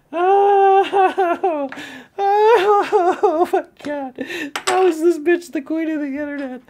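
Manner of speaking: very high, drawn-out exasperated moan; exasperated outburst. Real, not acted. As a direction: wail it high in disbelief.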